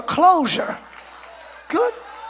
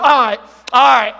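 louder mocking "All right, all right." with a falling brush-off; sarcastic all right; 2 semitones lower, 5 dB louder than usual. Preacher acting out fake agreement, mock sarcasm. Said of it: "That's being sarcastic".

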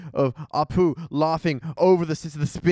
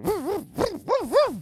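barking. Retold or imitated. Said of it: "he's just like".

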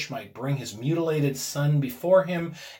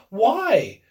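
higher, louder puzzled question; marveling; 8 semitones higher, 8 dB louder than usual. A narrator's reading. Husband marvels at his wife's demand, puzzled. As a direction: ask in wonder.

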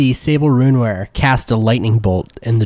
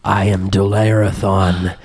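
low, slow dragon rumble; 3 semitones lower, slower than usual. Acted. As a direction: speak slowly like a menacing dragon. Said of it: "hear the voice of the dragon speak".

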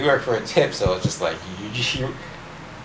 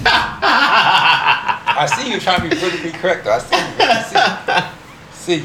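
laughing through the words, higher; laughing tease; 5 semitones higher, slower than usual. Real, not acted. Calling out a friend's careful wording, laughing tease.

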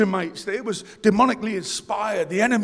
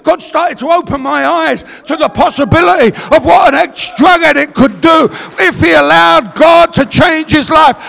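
loud, raised preacher voice; full-force preaching; 7 semitones higher, 13 dB louder than usual. Real, not acted.

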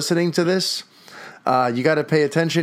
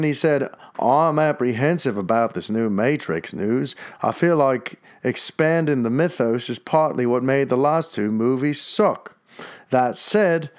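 hammy, badly done Australian accent; terrible Australian accent. Podcaster reading out a listener's comment in a bad Australian accent. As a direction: read it out like a bad Australian impersonator. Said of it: "that's my terrible Australian accent".

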